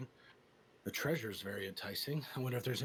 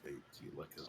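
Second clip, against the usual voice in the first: teasing stage whisper; 6 semitones lower, 9 dB quieter than usual. Acted. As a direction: whisper it to tease a friend.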